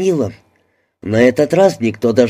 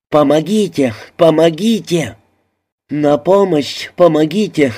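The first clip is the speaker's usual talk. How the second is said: higher-pitched, restrained calls for help; cries for help; 5 semitones higher than usual. A narrator's reading. Someone in the crush calls in French, crying for help. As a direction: call out in repeated mild alarm. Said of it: "раздалось по-французски".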